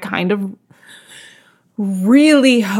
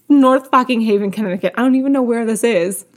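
ordinary talk

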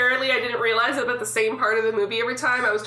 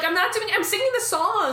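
mock innocent; 9 semitones higher than usual. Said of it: "I'm like".

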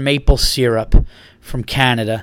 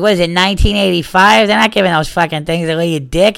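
mocking buddy; 5 semitones higher, 4 dB louder than usual. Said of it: "He's like".

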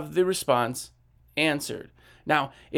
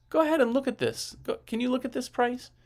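higher, light coaxing voice; friendly salesman nudge; 8 semitones higher than usual. Retold or imitated. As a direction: coax them to look at the price. Salesman nudging customer to price.

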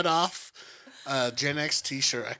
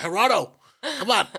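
calling out urgently; 6 semitones higher, 6 dB louder than usual. Retold or imitated. Calling out to counselor.

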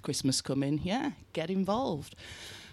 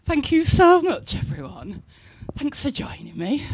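excited; 10 semitones higher, 5 dB louder than usual. Real, not acted. Excited thanks after the show.

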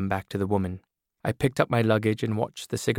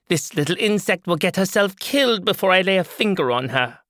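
higher, louder brassy woman's voice; loud, higher voice of a tough older woman; brash woman character; 10 semitones higher, 5 dB louder than usual. Acted. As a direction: play the hard-bitten woman with the scissors.

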